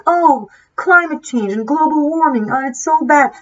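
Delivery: high, sing-song mock of a fake eco-worrier; mocking quote. Mocking people who only pretend to care about climate, high sing-song voice. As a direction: parody the hollow hand-wringing. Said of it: "to be like".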